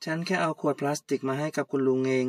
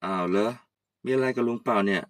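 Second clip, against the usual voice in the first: put-on much lower, easygoing old man greeting; old uncle; 6 semitones lower than usual. Old uncle greeting Kaew, much lower. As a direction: drop low like a relaxed old uncle.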